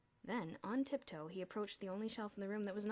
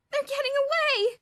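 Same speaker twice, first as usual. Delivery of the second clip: high, loud wail; 18 dB louder than usual. A narrator's reading. Elmer watching his ants escape, wailing. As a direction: wail in despair. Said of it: "Elmer wailed".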